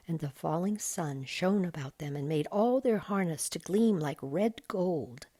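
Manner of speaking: ordinary delivery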